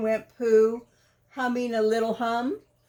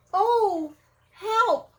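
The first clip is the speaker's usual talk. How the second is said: stuck and distressed; 9 semitones higher, slower than usual. Acted. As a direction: cry out for help, squeaking high. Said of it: "said Pooh".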